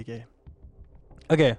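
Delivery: normal talking voice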